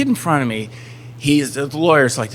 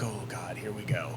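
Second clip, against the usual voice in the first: much softer groan of dread; 6 semitones lower, 17 dB quieter than usual. Retold or imitated. Client hearing bad news from his lawyer, soft dread. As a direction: mutter it under your breath, resigned. Speaker's inner dread retold.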